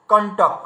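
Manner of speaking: কণ্টক said "kon-tok", retroflex n before t. Shown as a demonstration. Teacher showing students how কণ্টক is said. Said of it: "এটাকে আমরা বলছি কেমন?".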